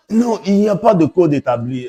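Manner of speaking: high, loud, insistent denial; emphatic objection. Real, not acted. Poet rejecting the idea of an established code, emphatically. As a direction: push back hard, pitched well up. Firmly rejecting the question's premise.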